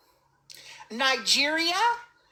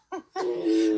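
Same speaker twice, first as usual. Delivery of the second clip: real high laugh; 7 semitones higher than usual. Real, not acted. Laughs at own accent attempt.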